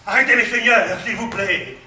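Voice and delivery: loud pleading shout; shouting to stop. Acted. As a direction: call out loudly to stop the fight. Calling to stop a duel.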